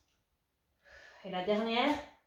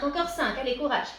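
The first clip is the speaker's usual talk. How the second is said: louder encouraging call-out; rallying call; 3 semitones higher, 6 dB louder than usual. Real, not acted. Coach cheering her class through the last reps, loud.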